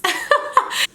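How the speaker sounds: bright laugh up high. Real, not acted. Laughing at beating her mama up, high laugh.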